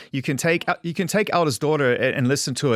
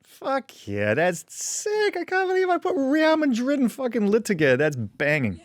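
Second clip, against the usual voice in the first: mock self-congratulation, mostly high-pitched; imagined self-congratulating songwriter; 10 semitones higher than usual. Host imagines the singer admiring her own lyric, mock self-congratulation. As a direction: play a songwriter tickled by their own lyric. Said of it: "just be like".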